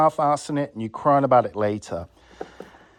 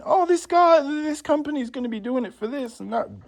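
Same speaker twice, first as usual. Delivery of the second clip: mock naive customer; 11 semitones higher than usual. Vlogger imitating customers picking a cheap company, mockingly naive. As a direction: imitate a gullible bargain hunter up high.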